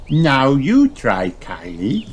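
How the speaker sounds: slow high piglet invitation; Peppa. A narrator's reading. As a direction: coax Kylie to have a go. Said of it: "she says".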